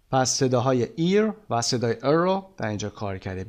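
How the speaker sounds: ordinary talk, nothing marked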